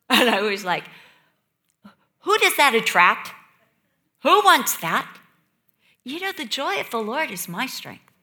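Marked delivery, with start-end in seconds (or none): 2.2-5.2 loud incredulous questioning; exasperated rhetorical questions, punched out. Retold or imitated. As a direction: protest in disbelief. Acts out own incredulous reaction.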